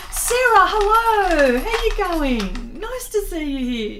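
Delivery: high, loud sing-out hello, easing down; excited greeting. Real, not acted. Host greeting Sarah on arrival, excited and drawn out. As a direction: stretch out a delighted, high-pitched welcome.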